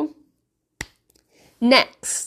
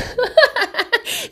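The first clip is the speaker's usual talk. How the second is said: bright high-pitched laugh; 14 semitones higher than usual. Real, not acted. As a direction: burst out laughing. Laughs at own joke.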